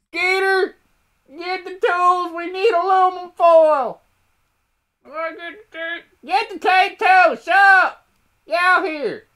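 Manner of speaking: loud, strained yokel yell; yelling hillbilly. Acted. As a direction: holler across the yard like a panicked hillbilly. Acted hillbilly yelling for Skeeter.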